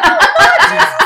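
high, laughing cry of awe; overwhelmed laughing gasp; laughing exclaim. Retold or imitated. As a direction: blurt it out through laughter.

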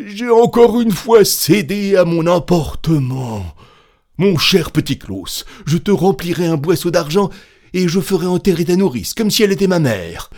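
loud, high wailing plea; lament, then bargain desperately; aubergiste suppliant. A narrator's reading. Innkeeper laments and begs. (supplication éplorée, forte et aiguë)

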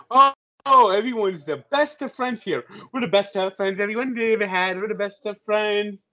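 high, sing-song mock sweetness; mocking gushing. Mocks what everyone says about team.

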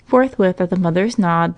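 plain reading voice

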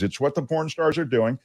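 said quietly, pitch still lifted. Real, not acted. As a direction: bring the volume right down. Repeats it lowered after yelling remark.

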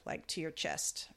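ordinary delivery

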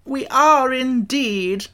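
high, slow, pompous insect voice; Wogglebug voice. Acted. Wogglebug answers Tip, high slow insect voice. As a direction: speak up high and drawn out like a learned bug.

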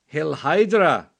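mock German villain salute; a hint of a stern German officer; German accent. Host greets guest in mock German accent. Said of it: "that German accent".

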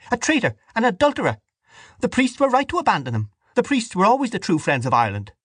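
high-pitched indignant woman; angry accusation. A narrator's reading. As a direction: denounce him in a high, heated voice. Dante's furious accusation in quarrel.

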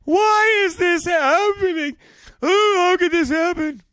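high, slow mock wail; mock sobbing Maxwell. Mocking Maxwell sobbing in court, high wail. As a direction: ham up a sobbing woman's lament. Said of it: "Ghislaine Maxwell sobbed".

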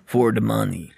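thick mock Russian accent, slow; slow, hammy Russian salesman voice. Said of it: "That's the most Russian I can do".

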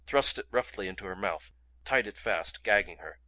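ordinary delivery

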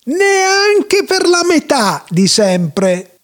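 high, long-drawn wailing yell; hammed-up high-pitched holler; theatrical howl. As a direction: howl it out, stretching every word. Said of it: "urlò Schmendrick testa matta".

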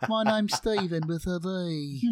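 high, slow mock English accent; hammed-up English accent from the show; imitating Moon Knight accent. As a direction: quote the line in a silly English accent. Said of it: "my daughter loves that".

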